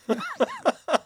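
high, helpless burst of laughing; burst of laughter. Real, not acted. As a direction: crack up mid-read, can't hold it. Breaks up mid-reading.

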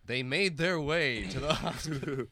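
slow storybook narration; narrator voice. Acted. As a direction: read it like a narrator, slowly. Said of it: "you don't have to narrate our own drive".

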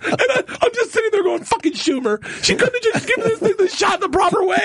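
high, laughing close to tears; cry-laughing. Real, not acted. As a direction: laugh until it cracks. Cry-laughing at the story's end.

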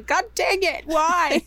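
high, loud mock outburst; exasperated retold yell. Retelling watching teaser videos at work, exasperated yell. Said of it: "at work going".

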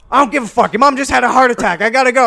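loud, high mock-dad yell; dad's frantic outburst. Dad brushing off his son's crash news, frantic yell. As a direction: imitate a stressed-out dad shouting, high and hurried.

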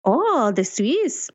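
slow, high, bad Swiss accent attempt; a clumsy try at a Swiss accent. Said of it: "That's not a Swiss accent".

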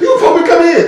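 high, exasperated reminder; scolding friend. Retold or imitated. Friend on phone voiced.

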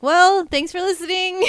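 cheery fake farewell; mock sign-off. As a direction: pretend final sign-off, high and loud. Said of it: "I'm just gonna pretend like".

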